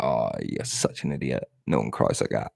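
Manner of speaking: a deep, muted scoff at yourself; low grumble. Retold or imitated. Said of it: "look back at that on YouTube later and be like".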